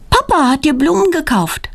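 put-on a high child character telling news; girl's voice. A narrator's reading. Daughter covering up to her surprised mother, high girl's voice.

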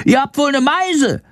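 very high squealed insult; Shandor scolding. A narrator's reading. Bat dodging angry swallows, high indignant squawk. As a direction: scold in a tiny high creature voice. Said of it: "keift Shandor".

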